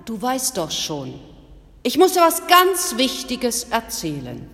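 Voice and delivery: agitated villager bursting with news; agitated man. Acted. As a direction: blurt it out like breathless gossip. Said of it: "kam ein Mann aufgeregt".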